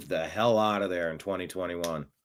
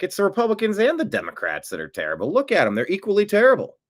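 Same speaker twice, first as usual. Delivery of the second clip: mock exasperation; 9 semitones higher, faster than usual. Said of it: "you're like".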